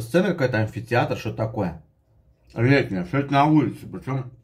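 chewing while talking; speaking between bites of pasta; mouth full. Real, not acted. Seen 0: Takes forkful of pasta at 0.7, then chews while speaking.

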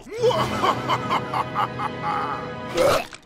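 triumphant villain cackle; evil laugh. Acted. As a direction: cackle with glee over the plan. Villain laughs after gloating.

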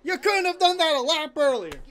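high, loud exasperated yell; outraged fan yelling at full pitch; furious shouting. Real, not acted. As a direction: scream at the screen in frustration. Angry that wreck came too late.

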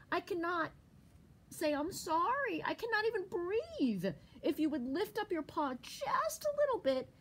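high, strained little squirrel; pleading squirrel. A narrator's reading. Squirrel begs Bear to lift his paw, high pleading.

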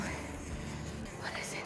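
slow, hushed whisper. Real, not acted. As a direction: breathe the question in suspense. Whispered as fish surfaces.

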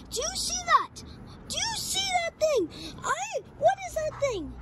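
very high-pitched panic; frantic high-voiced alarm; panicked question. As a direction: cry it out, desperate to be believed. Panics as nobody sees the shadow.

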